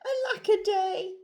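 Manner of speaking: hammed-up woeful wail; crying out. A narrator's reading. Narrator voices lonely Drip for young listeners, crying out. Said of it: "And he cried".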